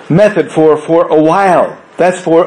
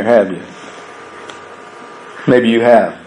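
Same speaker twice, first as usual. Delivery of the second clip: usual speaking voice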